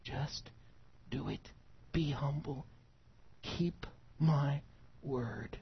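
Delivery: hushed, very soft command with pauses; gentle near-whispered instruction; King Jesus. Acted. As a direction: quietly still the argument, phrase by phrase. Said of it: "But he says".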